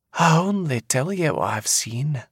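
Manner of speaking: a Scottish seer foretelling doom; eerie old seer. A narrator's reading. Seer speaks.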